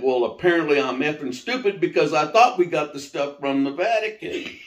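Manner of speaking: indignant outburst. Retold or imitated. [name] retorts to his mom, heated and indignant. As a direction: fire it back at mom, annoyed. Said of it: "I said".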